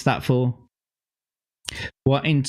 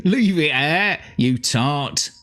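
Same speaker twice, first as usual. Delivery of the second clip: Cockney; 3 semitones higher, slower than usual. Pretend. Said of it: "says it in that Cockney voice".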